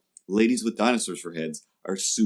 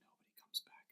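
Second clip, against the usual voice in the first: fast bitter whisper; resigned whispered aside; 24 dB quieter, faster than usual. Acted. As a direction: hiss it under your breath.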